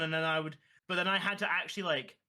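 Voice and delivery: usual speaking voice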